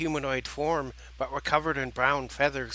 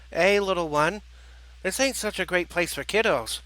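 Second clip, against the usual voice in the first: higher-pitched New Jersey character voice; New Jersey accent; 5 semitones higher than usual. Acted. Turkey warrior to a little girl, New Jersey accent. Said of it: "speaking with a New Jersey accent".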